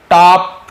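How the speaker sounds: "टॉप" loud with an open aw; emphatic model of aw in "टॉप"; right pronunciation. Shown as a demonstration. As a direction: punch "टॉप" with a rounded aw. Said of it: "ध्यान देंगे".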